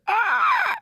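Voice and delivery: high shrieking screech; pterodactyl screech. Shown as a demonstration. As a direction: shriek like a flying dinosaur. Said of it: "I was thinking more pterodactyl".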